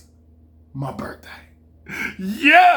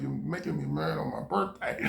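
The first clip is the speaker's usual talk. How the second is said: inner thought, quiet; 4 semitones lower, 10 dB quieter than usual. Retold or imitated. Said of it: "I'm thinking like".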